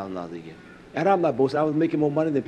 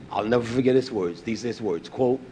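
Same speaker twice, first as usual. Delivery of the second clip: ordinary talk, nothing marked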